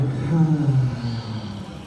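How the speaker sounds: low, breathy exhale; slow releasing sigh; long audible sigh. Shown as a demonstration. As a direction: let a big breath out with a sigh. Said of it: "exhale with a sigh".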